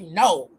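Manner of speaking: low, firm, emphatic no. Retold or imitated. Brother answering if she is crazy, emphatic. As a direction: imitate a flat-out refusal, pitched low. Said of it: "He said it emphatically".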